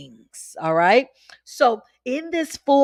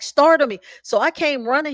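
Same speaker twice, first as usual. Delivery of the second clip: normal talking voice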